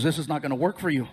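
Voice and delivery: low, quick doctor voice. Retold or imitated. Doctor ruling out surgery for the patient, low quick voice. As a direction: give the verdict low and fast. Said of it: "He goes".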